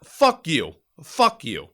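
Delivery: mock defiant yell; retold fuck you. Telling off the gunny, mildly yelled. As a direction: snap it back, half-hearted. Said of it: "And I'm like".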